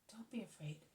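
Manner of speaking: soft breathy whisper, gently reassuring; encouraging whisper. Acted. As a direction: whisper it to calm a frightened woman. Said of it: "encouraging her".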